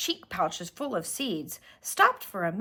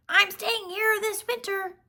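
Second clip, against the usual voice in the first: robin voice; 12 semitones higher than usual. Acted. Robin speaks.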